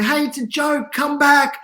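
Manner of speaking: high-pitched mock calling out; calling her back. Fake lifeguard to woman swimming out to sea, retold high-pitched call. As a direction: imitate calling after someone far away. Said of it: "had to call her back".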